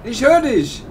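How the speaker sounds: ordinary talk, nothing marked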